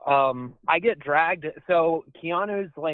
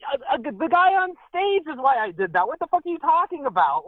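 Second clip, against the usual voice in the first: incredulous retort; 9 semitones higher than usual. Retold or imitated. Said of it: "I was about to say".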